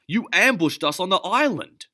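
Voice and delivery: very high and loud; furious yelling; angry shout. Acted. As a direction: yell the accusation. Elvin shouting in fight.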